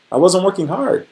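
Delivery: ordinary delivery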